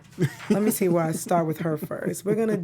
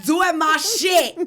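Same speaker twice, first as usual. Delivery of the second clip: raised, higher-pitched mock yell; loud, punchy New York-accented rant; 7 semitones higher, 3 dB louder than usual. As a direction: talk really loud, pitched up and indignant. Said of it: "I'm just gonna talk really loud 'cause [name] was yelling".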